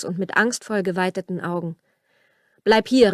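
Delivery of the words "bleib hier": louder, short warning call. A narrator's reading. Matthias tells his fiancée to keep back from the stallion, calling out. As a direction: call it out to stop her, lightly acted. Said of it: "rief Matthias ihr zu".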